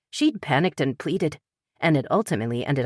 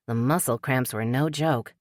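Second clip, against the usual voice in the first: ordinary delivery, nothing marked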